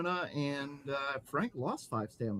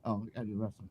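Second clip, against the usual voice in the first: usual speaking voice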